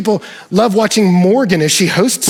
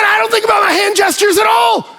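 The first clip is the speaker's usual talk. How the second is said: fired-up shout; 14 semitones higher than usual. Retold or imitated. Retelling his answer, fired up.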